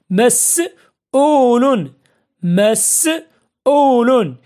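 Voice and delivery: مسؤول very slow, split by a pause after the first syllable. Shown as a demonstration. Teacher dictating an example to pupils, مسؤول slowly in parts. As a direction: hold each part of مسؤول, with a gap between.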